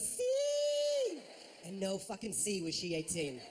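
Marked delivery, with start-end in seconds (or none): 0.0-1.2 put-on much higher, louder girlish yes; a high squeaky starstruck girl; squeaky teen girl. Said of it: "she goes".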